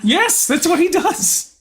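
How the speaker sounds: high-pitched, loud, drawn-out glee; delighted confirmation. Real, not acted. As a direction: squeal it with real delight. Delighted confirmation of listener's guess.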